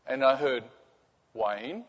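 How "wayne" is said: quiet, questioning call with rising end; wary calling out. Acted. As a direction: softly test if someone is there. Wife calls out in the dark.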